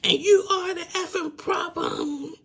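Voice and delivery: mock Angela yelling with a cry in it; retold screaming. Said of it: "started screaming at him".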